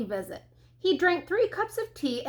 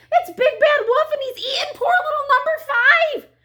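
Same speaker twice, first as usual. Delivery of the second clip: very high, loud duck shriek; enraged duck cry; 13 semitones higher, 8 dB louder than usual. A narrator's reading. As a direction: shriek the accusation in a duck's rage. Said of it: "she cried".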